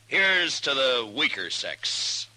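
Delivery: slow, drawn-out toast; wry toast. Acted. Matt toasts the men over Mamie, slow and wry. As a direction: raise the glass slowly, with irony.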